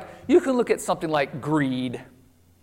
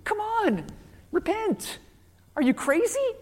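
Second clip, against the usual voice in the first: much higher, exasperated pleading; a prophet fed up with the king, pitch climbing; frustrated rebuke; 9 semitones higher than usual. Retold or imitated. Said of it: "Samuel gets more frustrated".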